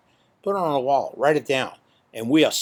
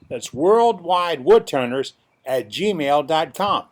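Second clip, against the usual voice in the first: announcer voice; 5 dB louder, slower than usual. Shown as a demonstration. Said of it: "here's the announcer voice".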